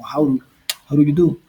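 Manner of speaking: "How do" said as "jau ru", the d tapped like r; "how do you do?" with a quick flapped d. Shown as a demonstration. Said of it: "entonces suena como".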